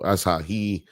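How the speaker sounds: normal talking voice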